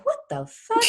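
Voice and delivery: startled squeal of disbelief; real laughing shock on waking. Real, not acted. Waking up shocked next morning.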